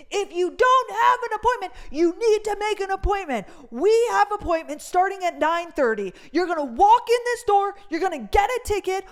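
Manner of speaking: high, loud, harsh shouting at the crowd; guard yelling. Retold or imitated. Armed guard shouting instructions at people waiting without appointments. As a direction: yell orders at a waiting line. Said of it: "He starts yelling".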